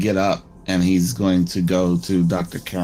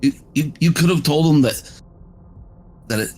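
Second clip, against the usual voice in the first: upset accusing; 5 semitones higher than usual. Acted. Upset confrontation at hotel door.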